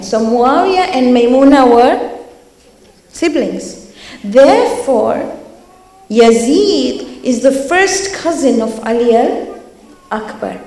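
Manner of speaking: loud, emphatic sermon telling; impassioned preaching. Acted. Preacher explaining Ali Al-Akbar's lineage to the congregation, loud. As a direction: build the case to the crowd.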